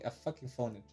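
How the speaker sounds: usual speaking voice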